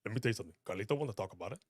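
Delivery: low, quiet put-on wrestler voice; Carlito. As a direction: drop low and soft, playing the wrestler. Puts on a Carlito voice.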